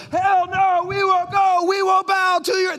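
high, loud protester's chant; mock protest shouting. Imagined protesters outside the palace, shouting in defiance. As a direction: shout it like an angry picket line. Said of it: "They weren't outside the palace going".

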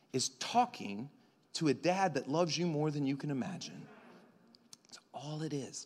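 ordinary talk, nothing marked

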